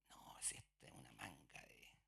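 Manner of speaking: hushed scornful aside; sneering whisper. Retold or imitated. Judeans whispering scorn about the Galileans. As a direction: whisper it with contempt.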